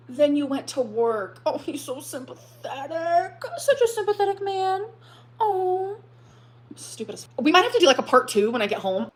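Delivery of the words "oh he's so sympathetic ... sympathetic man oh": higher, whiny mock-crying sarcasm; fake-sob the praise to mock it. Mocking Snowflake's praise.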